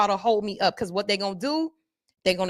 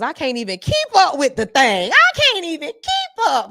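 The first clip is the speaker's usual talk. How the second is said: high, loud mock frantic commenter; mock frantic viewer; 7 semitones higher, 6 dB louder than usual. Reading a viewer's comment in a high mock frantic voice. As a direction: squeal it like an overwhelmed viewer. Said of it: "look at y'all in the comments".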